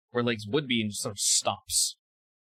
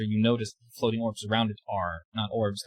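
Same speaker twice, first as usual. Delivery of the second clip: ordinary talk, nothing marked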